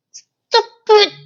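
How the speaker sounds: high, cracking cry of frustration; exasperated cry. Real, not acted. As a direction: wail it at the screen. Outburst at the show.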